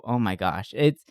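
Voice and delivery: ordinary talk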